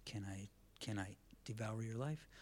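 sinister whisper; whispered devil. Acted. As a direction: creep up and ask in a whisper. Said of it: "he's like".

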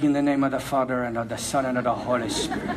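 low, soft priestly intoning. Retold or imitated. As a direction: bless him like an old Irish priest, hushed.

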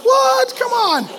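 high, mock-indignant disbelief; outraged surprise. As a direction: react like the score ruined the game. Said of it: "You're like".

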